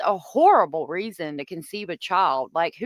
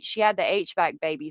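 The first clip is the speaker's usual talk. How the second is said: ordinary talk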